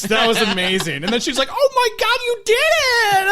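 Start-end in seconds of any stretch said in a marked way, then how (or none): 1.5-3.3 high, loud, stretched-out squeal of triumph; acted elated cheer. Imitating the girl cheering Willie's success, high elated yell. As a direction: cheer it with hammed-up joy.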